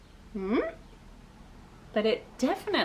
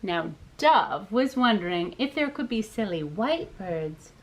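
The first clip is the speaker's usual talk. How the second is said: ordinary delivery, nothing marked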